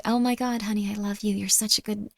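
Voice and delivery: soft, gushing mother voice; hushed maternal praise; loving mom. Acted. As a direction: dote on your child softly and tenderly. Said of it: "Don't you want a mom that's just like".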